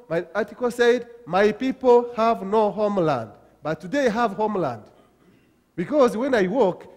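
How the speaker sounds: loud, high, fervent preaching; rousing pulpit climax; preaching at full force. Acted. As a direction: lift the room, proclaim it.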